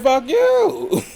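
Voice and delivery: high, crying mock retort. Imitating her crying retort, pitched high. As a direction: fuck you with a high, crying break. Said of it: "She went".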